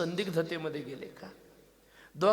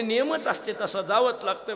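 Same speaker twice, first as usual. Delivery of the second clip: raised, exasperated wife's reply; sharp retort; 4 semitones higher, 6 dB louder than usual. Retold or imitated. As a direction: snap back at the husband, louder. Wife insists she must go. (बायकोचा चिडका, मोठ्या आवाजातला जवाब)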